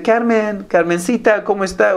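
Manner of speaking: overly familiar coworker. Retold or imitated. Coworker sweet-talking a woman at work, mock flirty. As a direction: mimic a flirty coworker using pet names.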